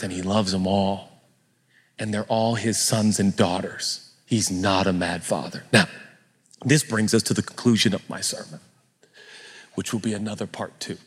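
usual speaking voice

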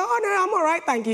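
high-pitched polite demurral, dropping on the thanks; polite British refusal. Retold or imitated. Speaker turning down her friend's cookie offer, politely. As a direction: act out politely turning down a treat. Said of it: "don't be polite and British".

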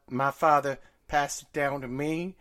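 put-on glamorous old Hollywood star voice; Tennessee transatlantic accent. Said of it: "some weird Tennessee transatlantic accent".

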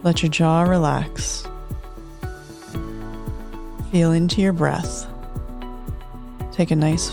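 unhurried soothing relaxation guide. Acted. Host leads closing guided meditation.

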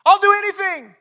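very high, loud, slow panicked shout; desperate cry for help. Acted. Climber dangling from a cliff cries to God, very high panicked shout. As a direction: scream it up to heaven, hanging on for life.

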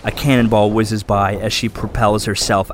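dramatic fantasy narration; story narration. A narrator's reading. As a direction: narrate the battle like an audiobook.

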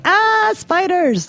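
high, drawn-out mock shriek; mock fright. Host imitating people who dread spiders, mock fright. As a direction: imitate someone squealing at a creepy-crawly. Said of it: "other people that are just like".

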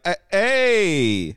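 imitating a Chicago "hey"; a light mock Chicago greeting; Chicago accent. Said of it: "when he was doing the Chicago accent".

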